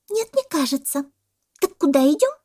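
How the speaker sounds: high, eager monkey voice; pestering monkey. A narrator's reading. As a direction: pipe up insistently as a monkey. (назойливая мартышка)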